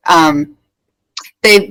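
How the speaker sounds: usual speaking voice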